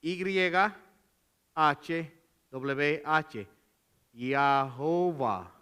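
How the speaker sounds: letters "H W H" then "Jehová", each drawn out very slowly; spelling it out. Shown as a demonstration. Preacher slowly spelling the divine name for the congregation. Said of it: "de la Y".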